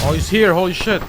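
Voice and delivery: sudden loud alarm; excited shout. Real, not acted. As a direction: yell out the spotted enemy. Enemy suddenly spotted in fight.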